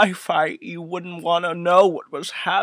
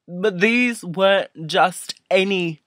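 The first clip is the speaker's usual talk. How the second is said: ordinary talk, nothing marked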